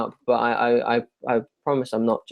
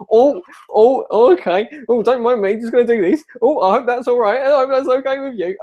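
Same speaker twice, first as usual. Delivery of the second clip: high, hesitant, apologetic weird bloke; unconfident geezer; 8 semitones higher, 3 dB louder than usual. Acted. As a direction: play a nervous geezer tiptoeing in. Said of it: "a weird geezerish, really unconfident person".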